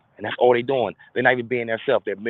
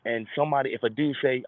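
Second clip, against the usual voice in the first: ordinary delivery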